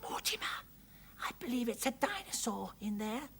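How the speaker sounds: put-on high, hushed little-girl voice; breathy excited whisper. A narrator's reading. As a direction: share a secret under the breath. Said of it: "whispered Arabelle".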